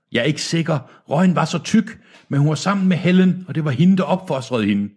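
high-pitched, anxious elder voice; frightened old man. Acted. Old man explaining himself to Deacon, high frightened voice. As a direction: answer in a high, cowed voice.

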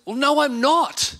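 a mild hint of a hurt child protesting; hurt young son. Son answering his dad, a touch of hurt child's voice. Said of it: "he looked up at me and said".

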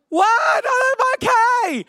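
high, drawn-out comic protest; slow, high-pitched mock holler; hammed-up shout back. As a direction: yell back uphill that you're fine. Yelling back up the hill.

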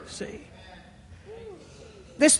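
ordinary talk

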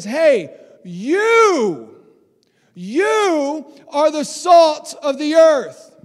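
loud, slow, declared to the whole crowd; booming proclamation. Acted. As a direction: call it out like a prophet, drawing each word out.

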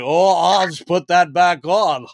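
slow, offhand cowboy drawl; John Wayne impression. Android reattaching his hand, mock John Wayne drawl. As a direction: shrug off the hand falling off. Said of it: "really cartoonish John Wayne impression".

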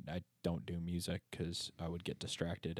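usual speaking voice

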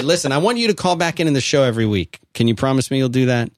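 normal talking voice

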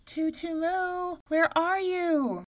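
high, slow calling out; mother calling. A narrator's reading. Mother searching the wrecked house, calling. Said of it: "She called".

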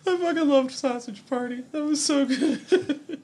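high, laughing delight. Real, not acted. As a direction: gush through laughter. Gushing, laughing about favourite episode.

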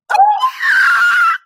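shrieking laughter at the excuse. Real, not acted. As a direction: burst into a disbelieving scream-laugh.

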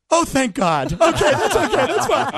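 high, loud, relieved mom voice, laughing at the end; a mom giddy with relief.

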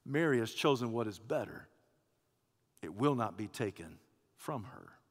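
soft, low, tender reply; calm her gently, unhurried; disarming, affectionate. Acted. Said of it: "in a disarming, affectionate way, simply says".

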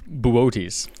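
"Buotes" as boo-OH-teez, three syllables; correct pronunciation. Shown as a demonstration. Host educating co-host, "Buotes" carefully pronounced boo-OH-teez. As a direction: sound out "Buotes" with a stressed middle OH. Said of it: "there's your education there".

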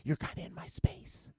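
breathy whisper, wife leaning in. Retold or imitated. Wife leaning in at church, whispering. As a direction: whisper it close, like mid-worship. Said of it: "leaned into me and was like".

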